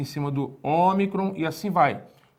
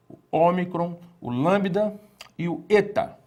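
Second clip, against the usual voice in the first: ordinary delivery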